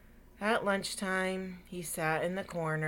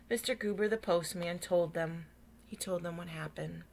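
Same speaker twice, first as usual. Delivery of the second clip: plain reading voice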